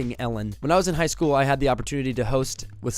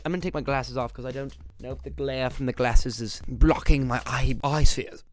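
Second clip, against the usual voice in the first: ordinary delivery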